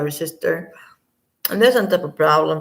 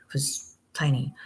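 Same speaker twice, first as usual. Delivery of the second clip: ordinary talk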